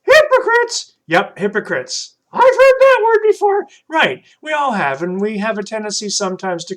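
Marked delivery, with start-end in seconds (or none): 0.0-1.0 high, loud puppet asking "Hypocrites?". Acted. Puppet Troy repeats the word. 2.3-3.7 put-on high, loud kid puppet voice; bright high puppet calling a friend. Puppet Troy replies.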